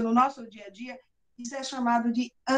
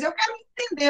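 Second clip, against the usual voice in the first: eager wish; 9 semitones higher than usual. Retold or imitated. Retold wish, repeated with emphasis.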